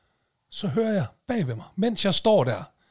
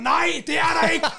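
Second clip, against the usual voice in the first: high-pitched, comic re-enacted rant; exasperated outburst; 10 semitones higher than usual. Irritated man telling a stranger to get the parking app, retold outburst. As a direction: snap the answer in pitched-up exasperation.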